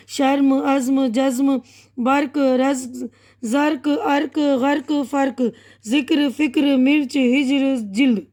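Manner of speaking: words run on in pairs with no pause; brisk run-through of the list; quick reading. Shown as a demonstration. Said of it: "अब मैं जल्दी जल्दी पढ़ रही हूँ".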